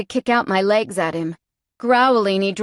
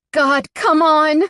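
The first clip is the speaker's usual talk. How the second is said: higher, louder, slow straining cry; strained cry; 7 semitones higher, 4 dB louder, slower than usual. A narrator's reading. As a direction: push through the effort, desperate. Straining against her binds.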